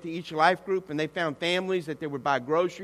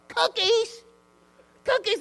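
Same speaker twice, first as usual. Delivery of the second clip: very high incredulous exclamation; mock outrage; slower than usual. Woman storming out of pastor's office, high mock outrage. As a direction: squawk it in acted disbelief.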